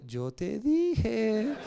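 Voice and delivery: very slow, drawn-out gloating; I-told-you-so. Retold or imitated. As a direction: stretch each word out, teasingly. Father's gleeful I told you so.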